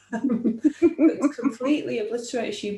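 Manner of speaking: laughing through the words. Real, not acted. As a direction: let a real laugh break in. Repeated line, laughing through it.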